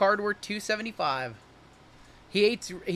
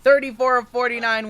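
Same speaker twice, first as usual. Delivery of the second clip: excited; 5 semitones higher, 9 dB louder than usual. Real, not acted. Excited at pulling a rat card.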